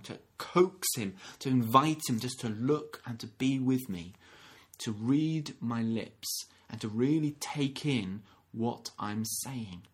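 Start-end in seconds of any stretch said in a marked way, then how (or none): none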